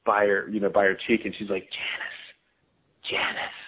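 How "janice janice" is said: urgent stage whisper, calling a name under the breath; yell whisper. Retold or imitated. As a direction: hiss the name to get a coworker's attention. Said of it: "like, yell whisper as if I wasn't gonna hear her".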